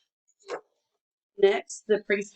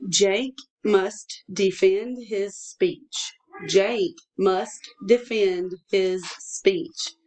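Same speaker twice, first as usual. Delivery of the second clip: very slow, each word set apart; slow dictation; much slower than usual. Shown as a demonstration. Teacher dictating a sentence to a pupil, slow dictation. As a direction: dictate word by word with small pauses. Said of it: "I'm gonna say it twice".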